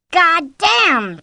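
high, loud angry outburst; yelled curse. A narrator's reading. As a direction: yell it in fury. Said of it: "John yelled".

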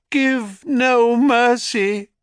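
high, slow echoed words; helpless echo. A narrator's reading. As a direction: repeat it like an echo. Echo repeats Hera's words.

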